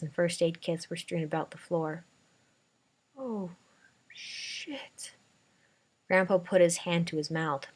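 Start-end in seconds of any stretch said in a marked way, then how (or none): 3.2-5.1 soft unvoiced gasp of dread; hushed dread. A narrator's reading. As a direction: breathe it out in horror. Grandpa sees the bleach.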